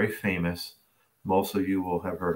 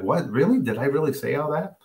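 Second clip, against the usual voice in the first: high, incredulous retold questions; imitated startled realization, pitch jumping up; taken aback; 6 semitones higher than usual. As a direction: question it in rising disbelief. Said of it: "taken aback".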